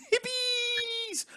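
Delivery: laughing mock cheer; silly squeal. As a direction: stretch it out in a comic squeak. Silly squealed team nickname.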